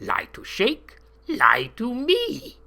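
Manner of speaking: high, slow broken-English accusation; accented guard. A narrator's reading. Guard Johann accusing the captive in broken English. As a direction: drag it out, accusing.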